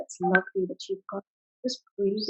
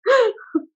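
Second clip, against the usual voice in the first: high burst of laughter; 13 semitones higher, 5 dB louder than usual. Real, not acted. Laughs over her healthy water.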